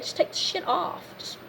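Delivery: near-whispered exasperation; quiet fed-up aside; hushed aside. Acted. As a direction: drop to a hiss of frustration. Exasperated advice, dropped voice.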